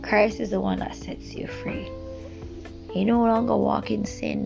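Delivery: ordinary talk, nothing marked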